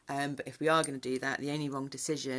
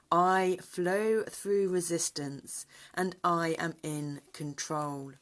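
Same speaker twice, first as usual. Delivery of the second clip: slow, louder chanted affirmation; incantation; 4 semitones higher, 4 dB louder, slower than usual. Shown as a demonstration. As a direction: intone each line slowly like a mantra. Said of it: "the affirmation, the incantation".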